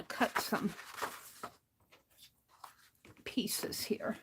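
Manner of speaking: half-whispered to herself; muttering to self. Real, not acted. Muttering to self while crafting.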